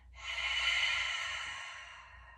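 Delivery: long airy open-mouthed exhale; breathy sigh. Shown as a demonstration. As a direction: sigh out as if fogging up a mirror. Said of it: "we'll exhale with a sigh".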